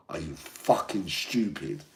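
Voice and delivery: ordinary delivery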